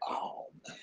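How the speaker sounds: breathy whispered "oh, man"; a weary whisper at the memory; whispered sigh. Real, not acted. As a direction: let it out as a tired whisper. Recalling dreaded Friday sermon prep.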